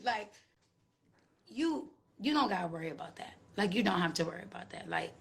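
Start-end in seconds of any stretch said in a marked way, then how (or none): none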